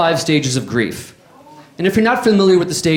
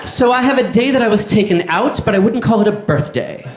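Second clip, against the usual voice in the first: light mock imitation of a date's answer; lightly voiced overheard woman, deadpan; same woman's voice; 4 semitones higher than usual. Comic quoting a woman on a date, lightly mocking. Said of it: "she said in this voice".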